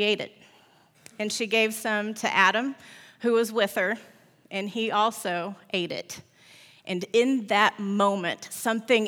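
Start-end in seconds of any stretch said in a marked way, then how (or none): none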